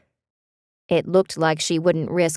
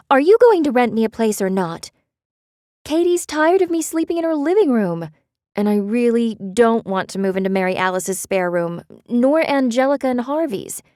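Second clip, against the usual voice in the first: frustrated plea; 6 semitones higher than usual. A narrator's reading. Beth pleading frustrated.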